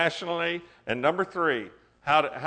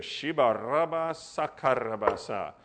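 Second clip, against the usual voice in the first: speaking in tongues; 2 semitones lower than usual. Real, not acted. Preacher praying in tongues.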